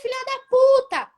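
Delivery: high-pitched imitation of someone yelling curses; mock shouting. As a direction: mimic a street swearer, pitch jumping way up. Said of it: "Você sair gritando, falando palavrão".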